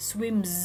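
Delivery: "swims" drawn out to a buzzing mz; voiced ending. Shown as a demonstration. Teacher repeating the example for students, "swims" ending in voiced z. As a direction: keep vibrating to the end of "swims". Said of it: "ม sound ก็เหมือนกัน".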